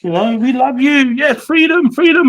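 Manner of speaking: imitating grateful locals. Soldier imitating Iraqis cheering the Australians, high mock praise. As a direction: gush like an overjoyed local welcoming troops. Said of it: "they're like".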